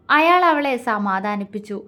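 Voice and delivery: usual speaking voice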